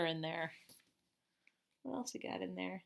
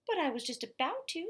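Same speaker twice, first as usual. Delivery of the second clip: put-on higher whiny boy voice; child voice; 9 semitones higher, 3 dB louder than usual. A narrator's reading. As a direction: protest like a little kid.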